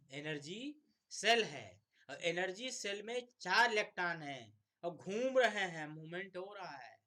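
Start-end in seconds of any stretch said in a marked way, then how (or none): 2.1-6.9 higher and louder, eager; excited announcement. Real, not acted. Teacher to students, excitedly describing how the electron is targeted. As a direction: announce it like a breakthrough.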